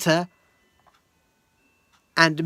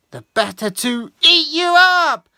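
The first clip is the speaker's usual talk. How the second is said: wolf's threat; 7 semitones higher, 7 dB louder than usual. Acted. Wolf threatens to eat her.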